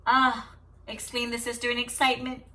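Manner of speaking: steady storybook reading to a class; story read aloud. Acted. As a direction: read the tale calmly to the children. Teacher reads story aloud.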